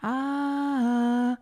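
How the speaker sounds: long, held echo vowel; echo answering. Acted. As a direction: mimic an echo from the lake. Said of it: "antwortet der See".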